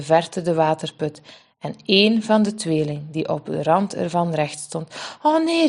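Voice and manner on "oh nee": higher alarmed cry; seeing the twin on the well's edge; a frightened exclamation. Acted. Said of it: "riep ze".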